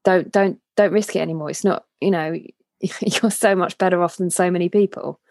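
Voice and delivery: usual speaking voice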